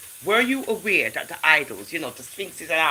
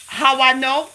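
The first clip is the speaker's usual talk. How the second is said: loud, high-pitched exclamation; screaming; 8 semitones higher, 8 dB louder, slower than usual. Real, not acted. Host loudly asking how she knows the story, screaming. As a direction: shout the question out high. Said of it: "Sorry if I was screaming".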